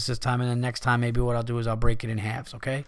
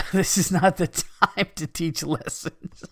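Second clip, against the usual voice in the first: laughing through the words, higher and slow; cracking up; 4 semitones higher, slower than usual. Real, not acted. Host losing it over a listener's email, cracking up. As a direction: break into real laughter mid-sentence.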